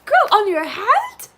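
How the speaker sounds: stunned, drawn-out reaction; shocked disbelief. Real, not acted. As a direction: drag it out in total disbelief. Reacting to friend's tattoo plan.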